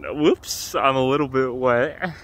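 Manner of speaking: normal talking voice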